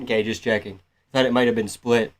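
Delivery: ordinary delivery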